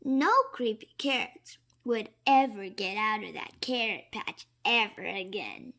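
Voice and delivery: ordinary delivery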